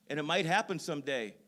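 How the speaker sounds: "happen" pushed high and loud; emphatic. Acted. Pastor stresses hope to congregation, emphatic. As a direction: land "It might happen someday" hard, with a big falling "happen". Repeats line, first loud then soft.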